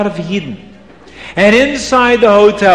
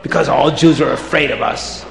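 gloating witch. Acted. Witches eyeing the crowd, gloating in a raised voice.

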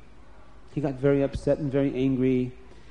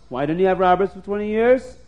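ordinary delivery, nothing marked

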